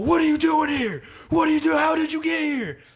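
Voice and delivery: very high, loud panicked demand; retold freak-out. Retelling him running out at her, loud freaked-out yelling. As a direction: shout it in a high-pitched imitated panic. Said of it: "he's, like, freaked out".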